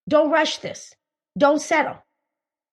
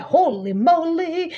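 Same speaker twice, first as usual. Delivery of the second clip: awed; 4 semitones higher, much slower than usual. Retold or imitated. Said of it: "you're like".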